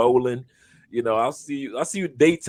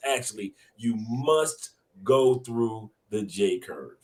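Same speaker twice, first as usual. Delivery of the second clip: slow and deliberate, landing the point; a coach's closing line, drawn out slowly; slow, weighty emphasis; 3 semitones lower, much slower than usual. Real, not acted. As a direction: lean on each word like the final lesson. Coach drops his closing gem.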